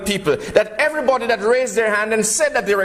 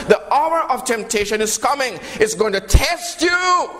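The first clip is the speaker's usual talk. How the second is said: preaching shout; 6 semitones higher than usual. Real, not acted. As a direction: call it out to wake the church. Preacher warns the congregation.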